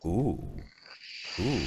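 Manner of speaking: ordinary talk, nothing marked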